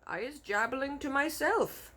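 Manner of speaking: a gentle giant, cornered; BFG voice. A narrator's reading. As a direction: answer him small and careful.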